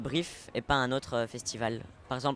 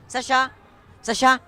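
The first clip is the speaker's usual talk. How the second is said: calling out; 4 semitones lower, 9 dB louder than usual. Acted. Calling someone over.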